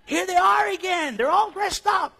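high, loud mock alarm call; dogs raising alarm. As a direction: shout it like a watchdog announcing strangers. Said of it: "When all the dogs start talking a certain way".

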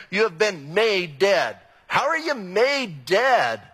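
slowed right down, each word weighed; slow emphatic. Acted. Pastor pressing a translation on his congregation, slow and emphatic. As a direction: let the words land one at a time.

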